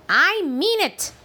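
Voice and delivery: loud, high firm insistence; firm scolding. Acted. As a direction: put your foot down. Gran insists firmly.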